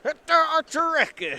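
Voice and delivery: high, slow, hammed-up Southern accent. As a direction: mangle the name in a thick Southern accent. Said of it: "say it in a very thick Southern accent".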